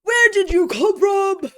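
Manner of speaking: high, loud retold shriek; mock startled yell. Streamer retelling being startled by him, mock shriek. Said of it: "And I'm like".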